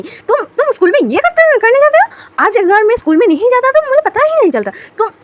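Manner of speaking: high, loud scolding shout; angry yelling. Acted. Wing yelling at runaway Ivan, angry high shout. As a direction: chase the kid down and yell at him. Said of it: "तो ऐसे चिल्ला रहा होता है".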